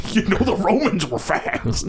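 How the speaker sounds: exaggerated old-time president voice; Richard Nixon. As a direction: put on a politician's voice, amused. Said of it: "In his fucking voice, too".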